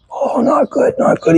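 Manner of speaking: a sore friend moaning the morning after; mock groaning Rick. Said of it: "he goes".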